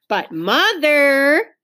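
put-on a child pleading urgently with her mother; urgent plea. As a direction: drag out the plea, insisting. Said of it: "insisted Ramona even more urgently".

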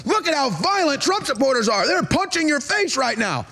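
high-pitched feigned outrage; mock accusation. Voices attacker blaming others.

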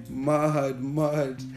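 normal talking voice

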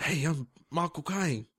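drawn-out mock London actor; Michael Caine. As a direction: ease into a slow Cockney star. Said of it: "I wanna do a Michael Caine impersonation".

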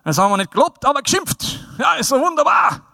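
mock Bavarian grumbler, rising high; high, hammed-up delight at having scolded; self-satisfied Bavarian. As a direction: crow with put-on Bavarian satisfaction.

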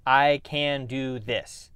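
each word placed, very slow; deliberate self-affirmation. Shown as a demonstration. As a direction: say it like you mean it, slowly. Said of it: "make an action plan and say".

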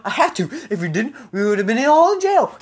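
ordinary delivery

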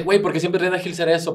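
mock customer complaint; complaining crowd. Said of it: "la gente nos dice".

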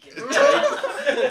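put-on high, loud southern accent; squeaky southern accent, laughing. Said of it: "weird southern accent".

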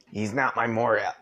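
strained after choking; throat catching. Real, not acted. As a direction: push through a sore throat. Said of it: "meat lunge itself in the back of my throat".

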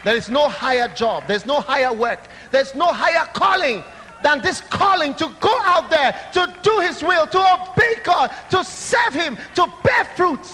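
high, loud pulpit shout; preaching at the top of his voice. Real, not acted. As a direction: build to a shout.

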